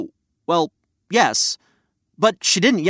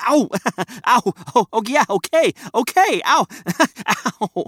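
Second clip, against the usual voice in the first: high yelps broken by laughs; high laughing yelps; 6 semitones higher than usual. Acted. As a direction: laugh and yelp at every poke. Poked again, laughing.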